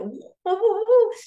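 playful imitated whoosh; mock wheelchair woo. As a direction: hoot it like racing away. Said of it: "he went".

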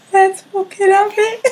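high, hyped up challenge; high mock challenge. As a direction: bring it on, voice up high. Repeats quote louder, higher.

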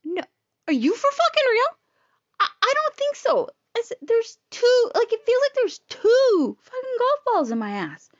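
high-pitched, indignant disbelief; incredulous protest. Retold or imitated. Retold argument with doctor over diagnosis.

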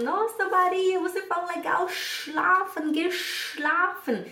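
imitação aguda e admirada; marido elogiando. Retold or imitated. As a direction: elogie encantado, esticando as palavras em tom alto. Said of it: "recebi até o elogio do marido". (elogio admirado do marido, encenado)

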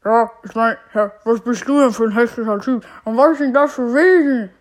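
loud, odd put-on genie voice. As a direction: boom in as a strange genie. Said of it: "ist das deine Stimme?".

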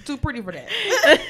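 high, loud, drawn-out joke; laughing boast. Real, not acted. Friend joking about cat fights, laughing high boast. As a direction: boast slowly through laughter.

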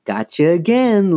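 ordinary delivery

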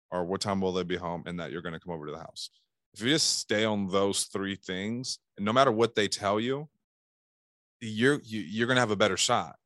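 ordinary delivery

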